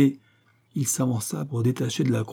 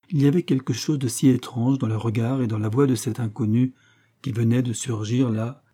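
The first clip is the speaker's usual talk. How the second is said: usual speaking voice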